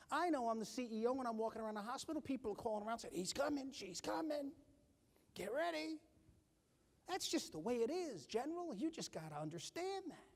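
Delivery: a hint of a dismissive executive; skeptical audience member. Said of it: "some of you are sitting there now going".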